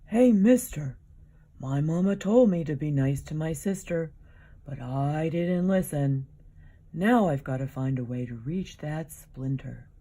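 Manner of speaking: put-on loud, pleading, childish complaint; a big hurt dinosaur begging for help; whiny dinosaur voice. Iguanodon asking Mario for help with the splinter, loud and whiny.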